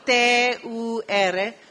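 T U R spelled out slowly, each letter apart and loud; spelling letter by letter. Shown as a demonstration. Teacher spelling the syllable to students, slow. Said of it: "La cinco".

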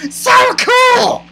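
very high, loud gushing squeal; mocking high-pitched cheer; mimicked cheering. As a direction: imitate a sideline fangirl squealing. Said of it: "She's saying".

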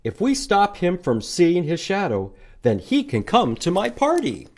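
excited moose plotting. Acted. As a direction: scheme out loud as the moose. Moose plans in picture book.

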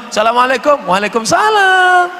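mock flirty phone call. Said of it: "jangan mendesah-desah".

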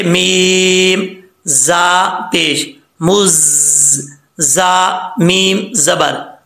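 मीम, जा पेश, मुज slowly, nasal m held long; spelling-out recitation. Shown as a demonstration. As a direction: build अल मुज़म्मी syllable by syllable on a level chant. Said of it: "तो इसको कैसे पढ़ेंगे".

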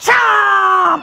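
loud monster bite shout; monster chomp. Acted.

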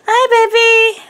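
gasp, then high delighted coo; overjoyed hello to the pet. Real, not acted. Greeting her dog, gasping.

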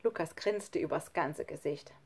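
usual speaking voice

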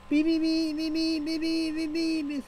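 high steady mock censor beeps; censor bleep. Mimicking a censor bleep while ranting about YouTube. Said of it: "censorship at its best".

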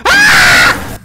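sudden loud low cry of fright; scared yell. Real, not acted. Startled player yelling when the monster appears. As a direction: yell as something leaps out.

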